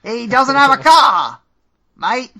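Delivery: loud, high, slow bad Australian accent; awful Australian accent. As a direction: ham up a terrible Australian accent. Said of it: "an awful attempt at an Australian accent".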